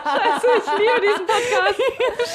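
high, slow, gushing through laughter; gushing excitedly. Real, not acted. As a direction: gush like a thrilled fan. Gushing over favourite podcast.

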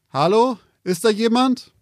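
uneasy call into the mist. Acted. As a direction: call out to someone unseen. Calling into the fog.

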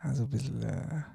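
fading hesitant mumble; trailing off. Real, not acted. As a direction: drift off, searching for words. Hesitant, searching for words.

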